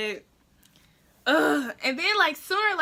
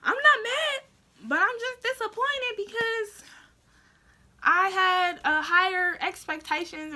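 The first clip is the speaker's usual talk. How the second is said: much higher put-on dad voice; dad disappointed; 7 semitones higher than usual. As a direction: imitate dad's letdown lecture. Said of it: "he's like".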